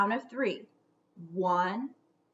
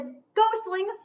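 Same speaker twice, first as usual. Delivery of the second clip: higher, louder suspicious mother; Gug scolding; 11 semitones higher, 8 dB louder than usual. Acted. Gug questioning the ghostlings, suspicious and louder. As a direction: catch them out with a knowing demand.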